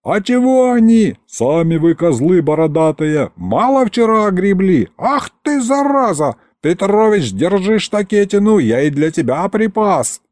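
high-pitched squabbling yells; angry crowd shouting. A narrator's reading. Rival mobs curse each other before the governor, shouting. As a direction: hurl insults across a brawling crowd. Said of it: "потонул в крике и мате".

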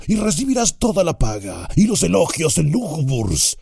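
shrill scornful goblin; high orc. A narrator's reading. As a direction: orc mocking the rival. Orc argues against.

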